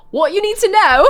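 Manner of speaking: posh Brit that slips to cockney; slipping into cockney. Shown as a demonstration. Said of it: "it got a little cockney there".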